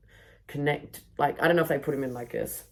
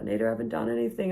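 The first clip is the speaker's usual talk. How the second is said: mock whiny plea; 7 semitones higher, faster than usual. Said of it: "he was getting sad".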